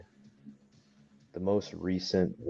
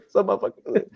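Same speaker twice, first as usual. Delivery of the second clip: shout; 13 semitones higher, 6 dB louder than usual. Retold or imitated. Calling back to his friend.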